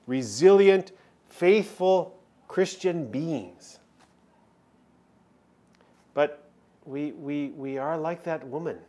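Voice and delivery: usual speaking voice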